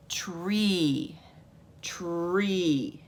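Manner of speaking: long drawn-out "Tree", twice; slow word presentation. Shown as a demonstration. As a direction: stretch "Tree" with a falling tune. Presenting the next word to sound out.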